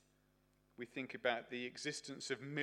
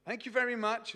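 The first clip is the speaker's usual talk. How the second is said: high, louder imitation of a shopper's thanks; voiced grateful shopper; 9 semitones higher, 7 dB louder than usual. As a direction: pipe up in a put-on grateful voice.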